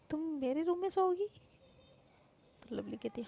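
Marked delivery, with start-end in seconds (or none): 0.1-1.3 higher, surprised ask; surprised question. Acted. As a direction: caught off guard, asking. Sister surprised by request.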